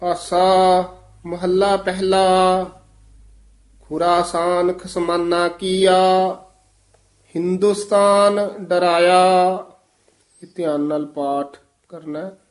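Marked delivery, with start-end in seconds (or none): 0.1-9.6 slow scripture recitation, long held endings; Gurbani ਪਾਠ. Acted. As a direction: recite each phrase slowly, stretching the vowels. (ਹੌਲੀ, ਲਮਕਾ ਕੇ ਗੁਰਬਾਣੀ ਪਾਠ)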